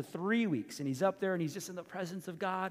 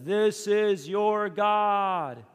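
higher, louder and slow, unveiling the calf; grand presentation; 4 semitones higher, 7 dB louder, much slower than usual. Retold or imitated. Aaron unveiling the golden calf to the people, slow grand announcement. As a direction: present it like a showman. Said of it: "he says".

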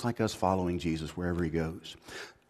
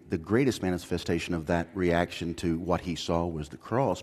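ordinary talk, nothing marked

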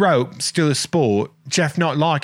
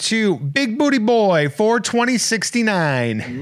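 goofy announcer; 9 semitones higher, slower than usual. Pretend. Goofy voice for joke name.

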